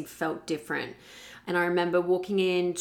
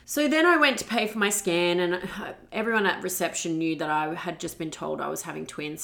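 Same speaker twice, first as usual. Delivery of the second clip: usual speaking voice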